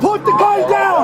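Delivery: shouting. Real, not acted. Officer orders armed woman.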